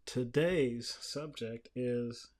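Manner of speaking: ordinary talk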